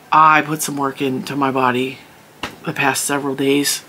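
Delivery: ordinary delivery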